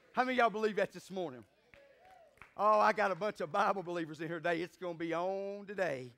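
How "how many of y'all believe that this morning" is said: ordinary talk, nothing marked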